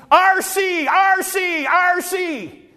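high, loud yell of a name, three times; calling a friend at full voice across the train yard; holler at the top of lungs. Retold or imitated. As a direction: holler it out to a friend far down the track. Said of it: "I'd holler at the top of my lungs".

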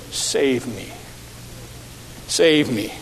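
hushed desperate prayer; pleading prayer. Acted. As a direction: beg quietly for rescue. Peter's short prayer.

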